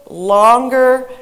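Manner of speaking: LONGER stretched out and loud. Shown as a demonstration. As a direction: draw out the word "longer" to show length. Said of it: "the center syllable was pronounced".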